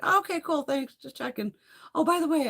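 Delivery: high, casual caller chirp; breezy phone voice. Acted. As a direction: toss it off lightly in a phone voice. Acts out her breezy phone reply.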